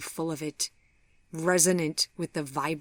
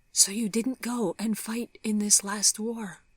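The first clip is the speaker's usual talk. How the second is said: raspy, breathless whisper; hoarse whisper; 6 semitones higher than usual. Acted. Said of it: "she whispered hoarsely".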